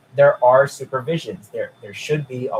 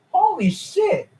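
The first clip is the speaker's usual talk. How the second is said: much higher amazed curse; stunned exclaim; slower than usual. Real, not acted. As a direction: gasp it out in shock. Reacting to absurd fan claim.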